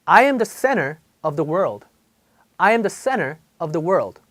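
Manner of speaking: center said like senner, the T vanishing between the vowels; dropped T. Shown as a demonstration. Teacher demonstrates the vanishing American T. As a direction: let the T in center disappear. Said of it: "자, 볼게요".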